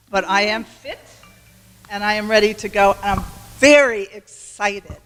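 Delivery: normal talking voice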